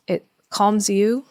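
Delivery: ordinary talk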